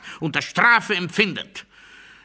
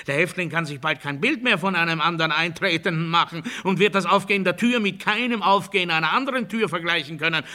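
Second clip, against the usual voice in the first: normal talking voice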